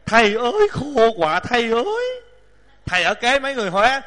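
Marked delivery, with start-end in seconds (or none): none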